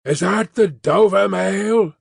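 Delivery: high, slow call through the mist; drawn-out distant hail; calling from afar. A narrator's reading. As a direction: stretch the words to carry over distance. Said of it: "a man's voice called from the mist".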